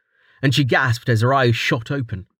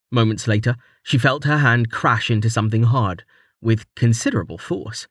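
ordinary delivery

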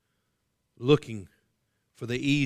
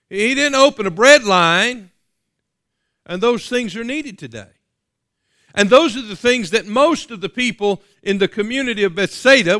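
higher, louder preaching that drives each point home; preaching at full force; 5 semitones higher, 6 dB louder than usual. Acted. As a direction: preach it out to the whole congregation.

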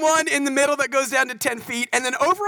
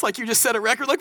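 ordinary delivery

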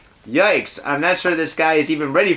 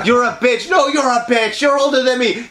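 high-pitched mock catfight; hammed-up squabbling women; girlfriend and mom bickering; 6 semitones higher than usual. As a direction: trade insults in a raised, put-on voice. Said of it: "having your girlfriend fight with your mom".

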